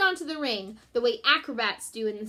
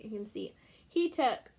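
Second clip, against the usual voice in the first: ordinary delivery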